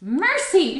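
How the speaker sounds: high-pitched cry of alarm; dismayed cry. A narrator's reading. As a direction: exclaim in motherly dismay. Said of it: "she cried".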